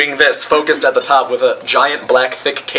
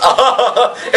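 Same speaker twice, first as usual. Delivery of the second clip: high, hammed-up evil laugh; villain laugh; 9 semitones higher than usual. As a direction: cackle triumphantly at your own scheme. Villain laughs after his plan.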